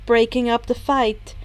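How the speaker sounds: usual speaking voice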